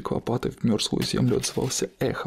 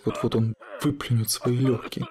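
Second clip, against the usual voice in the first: ordinary delivery, nothing marked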